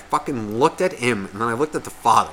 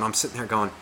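ordinary talk

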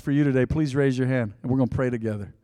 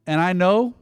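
quiet prayer; 5 semitones lower, 6 dB quieter than usual. Acted. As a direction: lower and much softer prayer.

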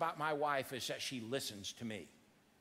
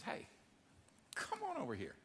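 gentle call; 3 semitones higher, 11 dB quieter than usual. Acted. Jesus calling the blind man.

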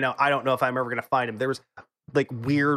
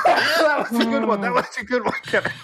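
higher, laughing, conceding the joke; laughing praise; 7 semitones higher, 3 dB louder than usual. Real, not acted. Caught off guard by Kevin's joke, laughing praise. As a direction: laugh out the compliment. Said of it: "You caught me off guard".

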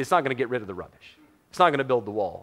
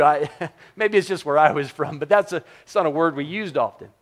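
usual speaking voice

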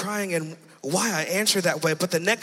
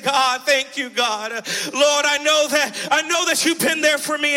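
raised, fervent cry of thanks to God; reenacted fervent praise; 6 semitones higher than usual. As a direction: call out thanks to God, pitch climbing. Acted praise to God in testimony.

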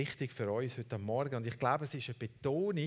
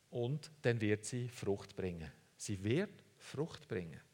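gentle reassurance; 4 semitones lower, 5 dB quieter, slower than usual. Acted. Preacher softens for the promise.

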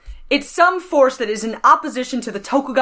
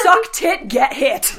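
mock slogan; 2 semitones higher, much slower than usual. Mock slogan amid laughter.

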